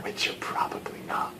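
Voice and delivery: very soft, voiceless tag-on; whispered aside. Real, not acted. Teacher to viewers who are not experienced readers, whispered aside.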